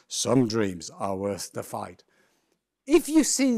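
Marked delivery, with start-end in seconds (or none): 0.1-2.0 much lower, slow American trailer voice; deep, drawn-out American movie-trailer read; slow, low movie trailer narrator in an American accent. Shown as a demonstration. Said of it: "American accented deep voice".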